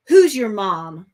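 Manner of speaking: loud, curt question; curt receptionist. A narrator's reading. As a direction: snap the question at the kid. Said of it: "the receptionist asks".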